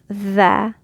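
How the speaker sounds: tha said with mouth wide open. Shown as a demonstration. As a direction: drop the jaw for tha. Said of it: "Open your mouth".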